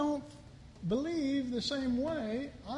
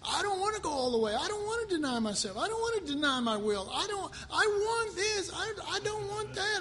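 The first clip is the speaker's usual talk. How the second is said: higher, fast whiny reluctance; mock reluctant seeker; 8 semitones higher than usual. Someone who came to church unwilling to commit, whiny. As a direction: voice a half-hearted churchgoer. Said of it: "they said".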